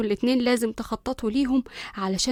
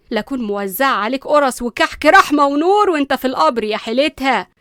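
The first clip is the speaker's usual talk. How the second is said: furious scolding; 9 dB louder than usual. Acted. Nanny scolds the spoiled son, loud furious yelling. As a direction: tear into him like an outraged nanny.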